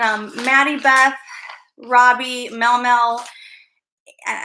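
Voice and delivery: ordinary talk, nothing marked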